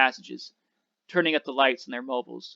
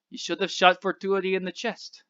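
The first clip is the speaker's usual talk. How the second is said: put-on higher French-accented voice, matter-of-fact; French accent, calmly second-guessing the shot; 6 semitones higher than usual. A narrator's reading. Abraham critiques the driver's plan, in a French accent. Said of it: "said the man with the French accent".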